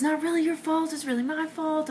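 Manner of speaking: high, soft whimper; hammed-up weepy confession; mock tearful self-blame. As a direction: whine it pitifully.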